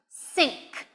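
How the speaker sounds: "Think" said as "sink". Shown as a demonstration. Teacher shows learners a mistake, "Think" with an S sound. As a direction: swap the TH in "Think" for an S. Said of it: "tempted to say an S sound".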